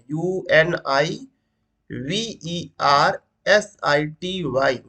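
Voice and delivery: slow letter-by-letter spelling; spelling aloud slowly; spelled out slowly. Shown as a demonstration. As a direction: spell it out one letter at a time. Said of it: "यू लिखा है".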